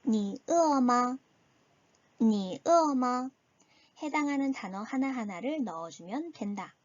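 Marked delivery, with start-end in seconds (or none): 0.0-1.2 니 으어마 spoken slowly, each tone drawn out; slow teaching read in Chinese; slow Chinese model. Shown as a demonstration. Teacher models the question slowly for the class. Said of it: "가볼까요". 2.2-3.3 니 으어마 said once at normal speed with clear tones; natural-speed Chinese repeat; Chinese at pace. Shown as a demonstration. Teacher repeats the question at normal speed. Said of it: "가볼까요".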